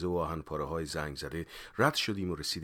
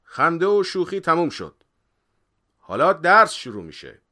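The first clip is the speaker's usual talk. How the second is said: loud, high classroom announcement; mock schoolmaster opening a lesson; stern lesson leader; 10 semitones higher, 14 dB louder than usual. A narrator's reading. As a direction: call the class to order. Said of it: "بغوث دوباره گفت".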